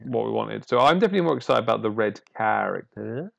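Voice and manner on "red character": "character" drawn out with a swooping rise at the end; odd intonation. Real, not acted. Host excited about the red card, "character" in an odd rising lilt. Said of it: "Don't know why I said it like that".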